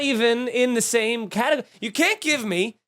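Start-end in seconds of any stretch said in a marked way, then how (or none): none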